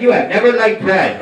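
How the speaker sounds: loud, emphatic mock-wife correction; overruling wife. Wife correcting husband on his taste in bread, loud overruling voice. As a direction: shut it down loudly as the wife.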